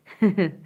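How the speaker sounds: short, loud giggle; quick burst of real laughter. Real, not acted. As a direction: chuckle after singing. Laughs after singing.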